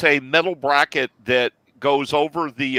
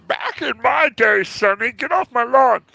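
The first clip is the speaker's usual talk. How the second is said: put-on high, cranky old-timer voice; very old man; 9 semitones higher than usual. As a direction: grumble it like a grumpy grandpa. Grumpy old-timer joke voice.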